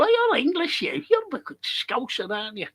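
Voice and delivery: squeaky mock voice, amused; high-pitched teasing impression of a foreigner; mock foreign local. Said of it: "Someone would go".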